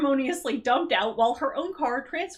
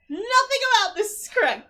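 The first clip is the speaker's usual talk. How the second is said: exasperated rant; 9 semitones higher, 5 dB louder than usual. Real, not acted. Ranting about the dumb reveal.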